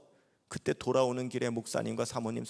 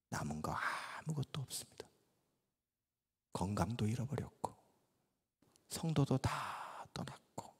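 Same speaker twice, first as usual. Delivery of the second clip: despairing whisper; 4 semitones lower, 7 dB quieter than usual. Retold or imitated. Depressed old pastor praying alone in the dark. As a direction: breathe out the prayer in hopeless whispers.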